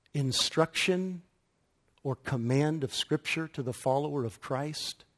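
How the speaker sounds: ordinary talk, nothing marked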